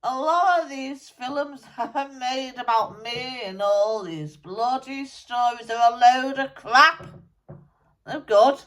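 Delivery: a dead rocker grumbling through a medium; channelled musician spirit. Acted.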